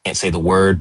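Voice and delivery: usual speaking voice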